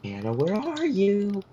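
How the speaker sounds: high, slow calling out; Maple calling out. Retold or imitated. Maple searching for Hannah, high slow calling. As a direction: call for her sister through the house. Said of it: "Maple says".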